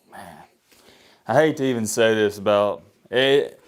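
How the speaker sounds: usual speaking voice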